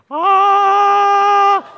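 high loud sung ah; a bright choir-like ah, high and loud; angel ah. Shown as a demonstration. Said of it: "practice that again".

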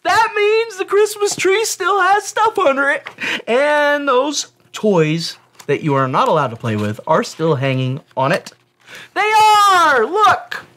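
put-on high, loud excited kid voice; excited child. Sibling waking David on Christmas morning, excited. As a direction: wake him up bursting with Christmas joy.

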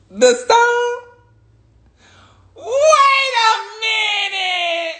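very high, drawn-out squeal of disbelief; hammed-up high cries, words stretched long; mock-astonished wailing. Speaker told the stone brings money, mock-astonished wailing.

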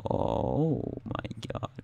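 slow breathless whisper; awed whisper. Real, not acted. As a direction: whisper it in shock. Whispered in awe after score.

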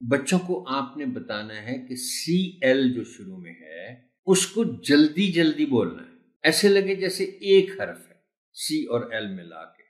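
ordinary talk

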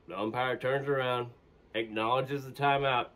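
ordinary delivery

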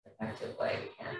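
a soft whispered self-correction under the breath. Real, not acted. Yoga teacher quietly correcting her own wording in a whisper. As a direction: murmur the fix to yourself. Repeats phrase, second time whispered.

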